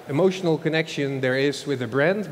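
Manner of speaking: ordinary talk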